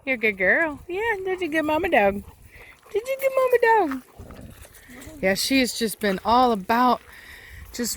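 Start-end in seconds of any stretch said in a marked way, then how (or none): 0.9-2.2 higher, sing-song praise for the dog. Real, not acted. 2.9-4.0 much higher, squeaky dog praise. Real, not acted. Praising the mother dog again.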